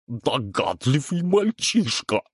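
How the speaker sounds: slow gurgling chorus of monsters; gurgling alien voice. A narrator's reading. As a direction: gurgle it like swamp creatures. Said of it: "пробулькали все в один голос".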